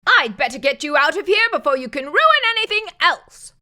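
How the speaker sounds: high, fast, scolding nurse voice; hurried nurse. A narrator's reading. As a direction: rush the words, mildly exasperated. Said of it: "the nurse said".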